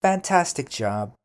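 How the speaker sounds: cheerful teacher praise. Real, not acted.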